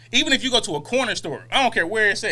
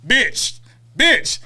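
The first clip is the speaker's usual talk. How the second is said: "bitch" said louder and rising, mock insult; hearing bitch; 3 semitones higher, 4 dB louder than usual. Hearing the server's sir as an insult, mock louder "bitch". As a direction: spit "bitch" out as the hidden meaning of sir. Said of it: "that's how I hear".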